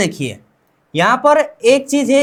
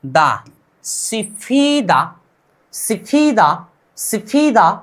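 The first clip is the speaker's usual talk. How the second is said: slow sounding out; much slower than usual. Shown as a demonstration. Teacher joining syllables for students, slowly stretched. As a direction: join "दा" onto "सिफीदा" very slowly. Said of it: "इसको बोलेंगे हम क्या?".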